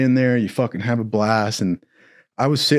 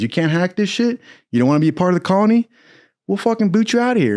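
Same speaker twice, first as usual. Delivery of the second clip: drill instructor; 6 semitones higher, faster than usual. Retold or imitated.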